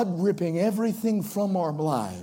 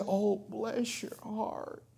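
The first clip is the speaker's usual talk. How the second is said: sympathetic bless your heart; 12 dB quieter, slower than usual. Shown as a demonstration. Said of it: "It's all in the voice inflection".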